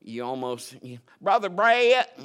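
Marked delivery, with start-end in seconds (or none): 1.2-2.2 high, loud Southern twang on "Brother Brett". Retold or imitated. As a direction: call out like a twangy church lady. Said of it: "had so much twang".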